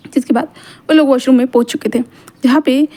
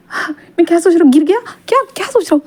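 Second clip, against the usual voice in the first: flustered surprise; 5 semitones higher than usual. Acted. Wang ji catches his own thought.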